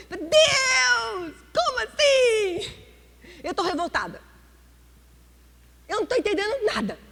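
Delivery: high, loud indignant complaint to God; a woman scolding God in prayer, high and loud; arguing with God. Retold or imitated. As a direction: protest upward, offended and baffled. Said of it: "eu brigo com Deus".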